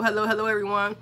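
ordinary talk

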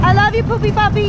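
high cooing to a puppy. Real, not acted. Baby talk to dog.

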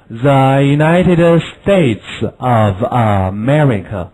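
slow, deliberate, every word laid out; slow model reading. Shown as a demonstration. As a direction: spell it out slowly for the class. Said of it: "美利坚合众国怎么说呢".

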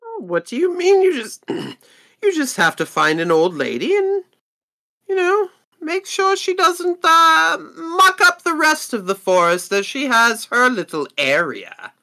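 exasperated merchant making light of the task; indignant merchant. Acted. Merchant pushes the job.

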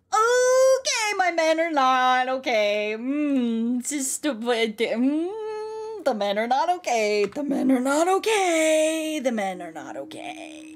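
loud, drawn-out mock wail, pitch climbing; panicked wail. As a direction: cry it out long and loud, sagging at the very end. Panicking over the men in game.

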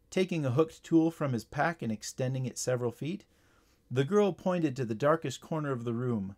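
usual speaking voice

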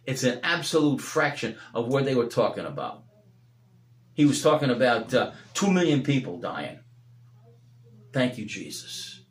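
fervent preacher, rising and falling; preaching. Acted. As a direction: preach it out to the congregation. Preaching at full force.

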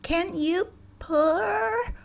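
very high, slow cat voice; a prim, questioning cat. A narrator's reading. Cat quizzing the duckling, high and slow. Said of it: "The cat said to him".

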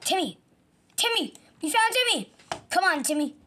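high, loud panicked cartoon voice; frantic game character. Victim finds Timmy.

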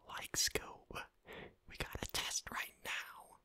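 Shaggy voice. Retold or imitated. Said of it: "when you have someone going, Shaggy voice".

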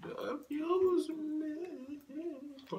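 high, stretched-out words; drawn-out repeat. Pretend. Repeating the question for a friend, drawn-out high voice. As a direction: drag each word out in a high voice.

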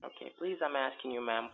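usual speaking voice

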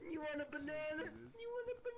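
put-on high sing-song baby talk to a pet; mocking baby talk. Gamer offers the lonely monkey a banana in high baby talk. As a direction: coo at him like a toddler, high.

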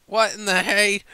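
high, loud shocked cry; startled exclamation. Acted. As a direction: yelp it out in shock.

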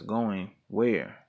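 plain reading voice

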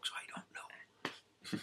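breathy whisper, fading away; whispered aside. Real, not acted. Guest admitting to the host he doesn't use Facebook, whispered. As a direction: confess it in a whisper.

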